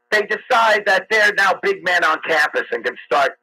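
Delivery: usual speaking voice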